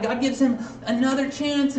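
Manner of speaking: usual speaking voice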